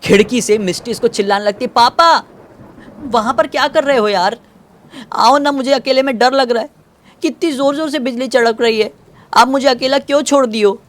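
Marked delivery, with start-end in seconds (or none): 1.7-10.8 put-on high childlike voice, eerily calm; a small daughter speaking sweetly to papa; little girl voice. (ऊँची, मासूम बच्ची की आवाज़)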